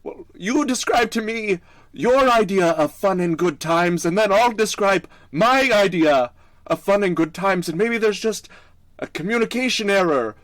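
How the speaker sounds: high, loud protest called out; yelling over. Acted. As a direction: holler your case across the distance. Still yelling at centaur in character.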